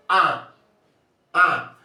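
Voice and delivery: isolated nasal "Ah"; nasal vowel demo. Shown as a demonstration. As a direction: sound "Ah" through mouth and nose, as a teacher's model. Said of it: "pronouncing with mouth and nose".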